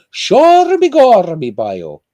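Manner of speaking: loud, high, drawn-out stage Irish; over-the-top Irish caricature, pitched high. Acted. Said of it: "every Irishman says".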